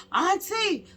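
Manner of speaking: high, loud panicked cry; frantic niece. Retold or imitated. Niece phoning her aunt in alarm, frantic retold cry. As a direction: shriek it in alarm, imitating the niece. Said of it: "like, she was frantic".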